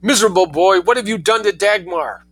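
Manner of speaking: high, loud frantic scolding; frantic outcry. Acted. Mother pounding at her son's door, frantic outcry. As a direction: beat on his door with your voice. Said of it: "outcry was easily surpassed".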